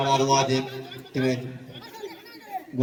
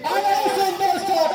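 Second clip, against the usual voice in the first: excited. Real, not acted. Near miss on goal.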